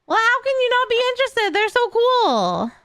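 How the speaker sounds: very high, gushing fangirl voice; excited squeal. Real, not acted. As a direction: squeal it out, then drag the cool. Fangirling over Sisters of Battle.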